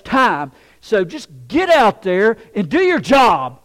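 loud, high-pitched angry father yelling orders; full-force retold scolding; yelling dad. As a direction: yell at your son to get working. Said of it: "he just started yelling at me".

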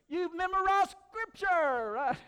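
high, loud, drawn-out hurrah; exultant cheer. Real, not acted. Preacher cheering listeners who learned a verse, exultant shout. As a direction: celebrate it at the top of your voice. Preacher mock-celebrates the congregation's recitation.

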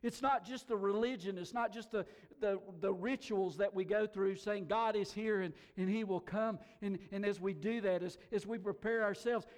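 ordinary talk, nothing marked

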